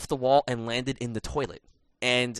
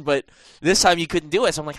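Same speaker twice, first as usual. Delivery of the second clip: ordinary talk, nothing marked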